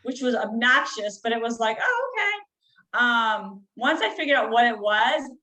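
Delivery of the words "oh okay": surprised relief. Real, not acted. Sudden realization after crying.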